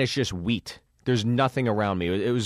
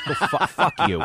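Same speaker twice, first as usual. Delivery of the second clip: laughing insult; 6 semitones higher than usual. Retold or imitated.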